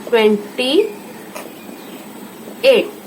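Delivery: ट्वेंटी, a pause, then eight; twenty eight said in two halves for writing down; split for dictation. Shown as a demonstration. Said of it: "ये है twenty eight".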